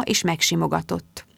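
ordinary delivery, nothing marked

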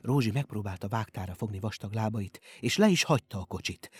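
ordinary delivery, nothing marked

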